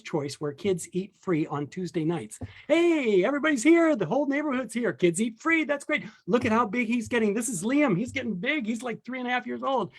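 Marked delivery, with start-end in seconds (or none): 2.7-9.9 high-pitched, gushing hello to the neighbours; excited greeting. Acted. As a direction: act out a happy social greeting, pitched up. Acted greeting of friends at restaurant.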